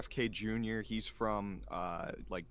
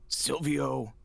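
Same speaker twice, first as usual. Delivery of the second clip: Silvio impression; 6 semitones higher, slower than usual. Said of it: "I forgot how Silvio sounds".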